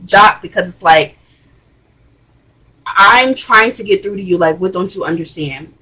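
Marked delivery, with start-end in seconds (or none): none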